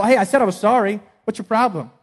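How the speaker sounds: indignant, huffy retort; mock indignant. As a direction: play the defensive offender. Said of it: "Don't demand".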